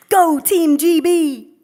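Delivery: slow, high, loud mock cheer; hammed-up patriotic cheer. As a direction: cheer it out to humour him. Cheering to please driver.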